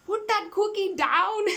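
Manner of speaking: high, slow, hammed-up Austrian-accented growl of a command; imitating an Austrian action star ordering someone to drop the cookie; Arnie's Austrian accent. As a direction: bark it out in a mock Arnold Schwarzenegger accent. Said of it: "how he says it with his accent".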